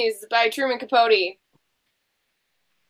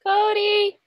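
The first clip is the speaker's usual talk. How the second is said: calling out; 8 semitones higher than usual. Real, not acted. Calling frozen guest.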